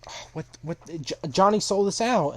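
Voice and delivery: mock indignant disbelief; shocked outrage. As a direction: act out stunned betrayal, rising into a loud accusation. Character realises he is betrayed.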